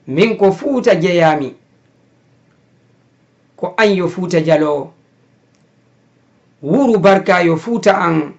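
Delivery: measured poem reading, long pauses between lines; poem recitation. Acted. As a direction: recite a poem line by line, lifting each opening then falling. Recites own poem after introducing it. (jangugol gimɗol e Pular)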